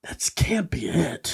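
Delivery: slow hushed whisper. Real, not acted. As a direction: breathe it in disbelief. Hushed disbelief.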